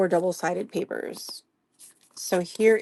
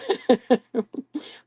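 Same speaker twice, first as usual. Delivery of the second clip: higher-pitched burst of giggles; amused laugh; 7 semitones higher than usual. Real, not acted. Host laughing off her accent slip, amused laugh. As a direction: break into giggles at yourself.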